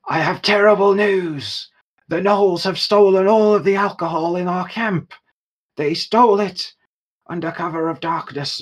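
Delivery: high, theatrical plea to a giant; hammed-up, high-voiced tale of woe; Solara. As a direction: deliver terrible news in a grand, high-pitched voice. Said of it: "And Solara goes".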